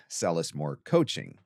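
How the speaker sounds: usual speaking voice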